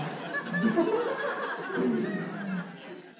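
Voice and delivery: very high burst of laughter; high squealing laugh. Real, not acted. Laughing at his own joke on stage, high squeal.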